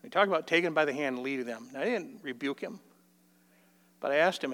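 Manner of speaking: ordinary talk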